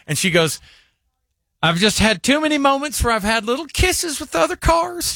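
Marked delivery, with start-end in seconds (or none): 1.6-5.1 put-on very high, dainty old lady voice; coyly confess, pitched far up; Martha. Old lady admitting fender kisses with other cars, very high voice. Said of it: "the way she said it".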